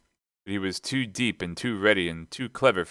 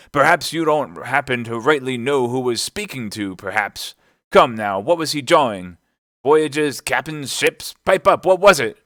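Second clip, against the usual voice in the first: high, loud string of questions; impatient landlord grilling a sailor; badgering interrogation; 6 semitones higher, 7 dB louder than usual. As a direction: press harder, building to a shout. Silver grills Morgan.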